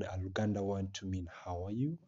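ordinary talk, nothing marked

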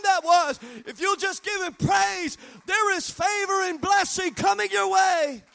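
loud, high, driving preacher's cry; full-force preaching. Acted. Pastor rousing his congregation to praise, preaching at full force. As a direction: preach it hard to the back row.

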